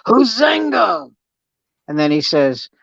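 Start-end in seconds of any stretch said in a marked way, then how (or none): 0.1-1.1 a slow, higher chant-shout of Huzenga. Acted. The tribesmen cheer the peace speech with a slow Huzenga shout. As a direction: bellow it like a whole tribe answering. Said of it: "And they all go".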